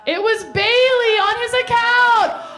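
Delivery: high, loud, slow outraged cry; shocked wail. Real, not acted. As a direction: wail out the twist. Reveal of the catfishing twist.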